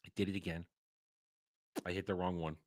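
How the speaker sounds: ordinary delivery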